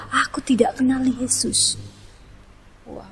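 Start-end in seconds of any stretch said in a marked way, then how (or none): 0.1-1.8 hushed, breathless denial; frightened whisper. Retold or imitated. Peter, scared, denies knowing Jesus in a whisper. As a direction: whisper it so no one overhears.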